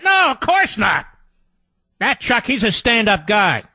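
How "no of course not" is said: sarcastic. Real, not acted. Sarcastic denial.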